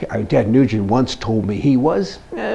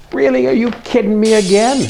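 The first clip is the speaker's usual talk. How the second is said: incredulous; 10 semitones higher than usual. Real, not acted. Host ranting about Canadian aid, exasperated. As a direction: throw it up high in exasperation.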